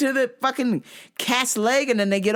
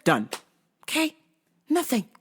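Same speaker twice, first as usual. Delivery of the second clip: usual speaking voice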